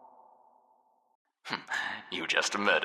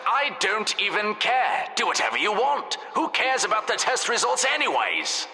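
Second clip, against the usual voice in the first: mock indifference; 14 semitones higher than usual. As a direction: pretend to give up. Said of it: "Only joking".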